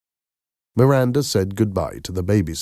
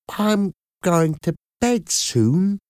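put-on smiling child voice; 13 semitones higher, slower than usual. A narrator's reading. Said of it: "Natty said with a smile".

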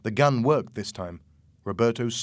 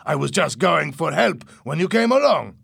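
high, loud self-defence; loud protest; 6 semitones higher, 7 dB louder than usual. A narrator's reading. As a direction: insist loudly on the excuse. Louis lies amid shouted confrontation.

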